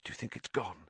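very soft, hushed question; cautious whisper. A narrator's reading. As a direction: ask it barely above a breath. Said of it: "Harry whispered".

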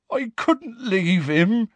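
slow, high-pitched tearful plea; pleading, high and slow. A narrator's reading. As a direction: draw it out, close to weeping. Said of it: "tears now trickling down his bruised face".

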